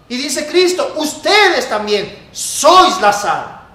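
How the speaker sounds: loud, high, driving sermon; fiery preaching. Acted. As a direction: preach it with rising force. Preaching at full force.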